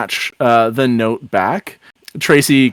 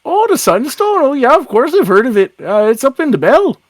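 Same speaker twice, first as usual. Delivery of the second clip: swooping small-town lilt; folksy Irish-Canadian officer, breezy; 9 semitones higher, faster than usual. Acted. Officer telling kids where Sunstone is, breezy folksy lilt. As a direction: answer breezily as the local cop. [name] answers about Sunstone.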